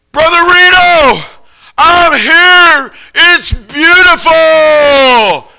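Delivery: high, loud, slow cry of joy from the summit; triumphant shout. Retold or imitated. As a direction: stretch it out in awe and call it down the slope. Reaching the summit, calling down.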